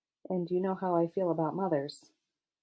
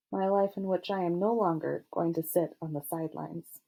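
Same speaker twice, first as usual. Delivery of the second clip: ordinary delivery, nothing marked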